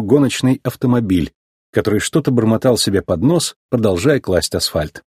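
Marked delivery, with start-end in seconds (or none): none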